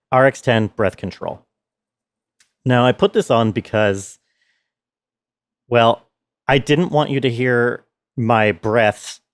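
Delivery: ordinary delivery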